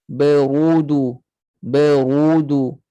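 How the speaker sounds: BERUDU slow, heavy dark RU; thick ru. Shown as a demonstration. Teacher demonstrates correct sound, thick ru. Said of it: "bunyi ru yang tebal bunyi macam tu".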